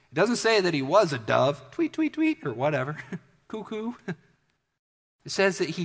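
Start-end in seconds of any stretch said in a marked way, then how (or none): 1.7-2.5 high-pitched mock tweets; bird chirping. Imitates a bird in a joke. 3.5-3.9 high, soft cuckoo; jokey imitation of a bird call; mock bird call. Imitates a dove call in jest.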